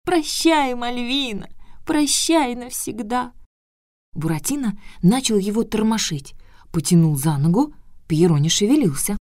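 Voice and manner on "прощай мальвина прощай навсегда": high, slow squeaky lament; wailing boo hoo crying. Acted. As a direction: wail it as if dying. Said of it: "пропищал загадочную фразу".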